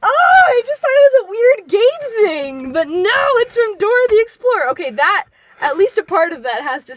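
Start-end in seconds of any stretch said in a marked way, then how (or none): 0.0-4.7 excited. Real, not acted. Excited epiphany.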